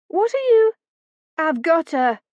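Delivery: shocked in the dark; startled cry. A narrator's reading. Phoebe startled in the barn, high and loud.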